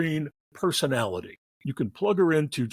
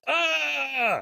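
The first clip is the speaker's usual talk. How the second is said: mock scream; 13 semitones higher than usual. Mimics actress screaming in box.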